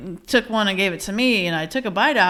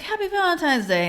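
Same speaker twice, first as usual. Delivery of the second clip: Logan's greeting pitched even higher; son's high greeting; 9 semitones higher than usual. Retold or imitated. Son Logan answers his mom's greeting, high and chirpy. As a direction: echo it back up high like a kid. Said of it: "He's like".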